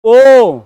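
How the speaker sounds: ओ called out loud with rounded lips; demonstrated vowel. Shown as a demonstration. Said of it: "आप बोलते हैं".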